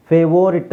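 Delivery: ಫೇವೋರಿಟ್ with long o, fe-VO-rit; wrong pronunciation. Shown as a demonstration. Teacher showing what we don't say, wrong pronunciation. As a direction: sound a full o in ಫೇವೋರಿಟ್. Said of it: "ಅಂತ ಹೇಳಲ್ಲ".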